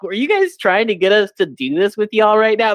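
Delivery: high, disbelieving question; mock incredulous. Asking the Waffle House staff, high and incredulous. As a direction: pitch it up like you can't believe it.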